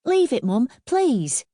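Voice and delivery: put-on high boy begging his mum; high-pitched, insistent appeal; pleading. A narrator's reading. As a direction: plead urgently in a young lad's voice. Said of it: "Daniel was still pleading with his mother".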